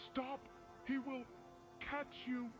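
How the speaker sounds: ordinary delivery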